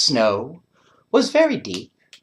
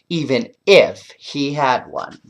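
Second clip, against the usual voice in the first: plain reading voice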